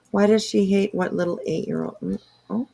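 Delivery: normal talking voice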